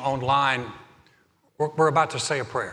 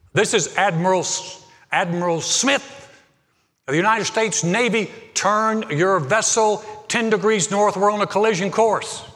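angry admiral; 7 semitones higher, 6 dB louder than usual. Retold or imitated. Offended admiral demands the other ship turn, loud and angry. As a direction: bark out your rank like an offended admiral. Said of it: "that made that admiral mad".

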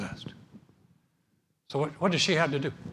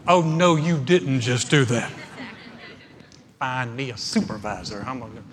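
mock sassy outrage; 3 semitones lower, 5 dB louder than usual. Offended customer inner voice.

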